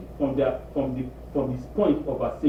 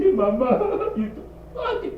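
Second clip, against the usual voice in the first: mock crying; 9 semitones higher than usual. Daughter crying back later.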